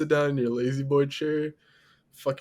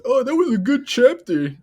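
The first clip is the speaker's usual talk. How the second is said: much higher, louder prissy reader voice; mock nerd; 10 semitones higher, 9 dB louder than usual. Teasing his friend for reading Fight Club, in a high, loud mock nerd voice. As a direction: ham up a pleased bookworm. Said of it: "Fucking nerd".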